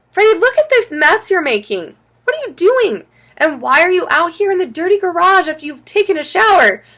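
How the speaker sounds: high, loud scolding; scolding mom. A narrator's reading. Mom catches Freddie making a mess in the garage, scolding.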